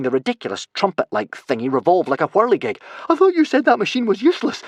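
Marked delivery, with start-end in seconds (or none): 3.1-4.6 high, loud, panting protest; breathless, high-pitched and loud; panted. A narrator's reading. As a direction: pant it out in alarm. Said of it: "panted Fishlegs".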